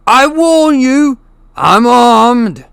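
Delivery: high, slow, loud shaky-brave warning; panicked threat. Acted. Uncle Vernon warns the intruder through the door, shouting. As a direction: shout the threat to sound armed and dangerous.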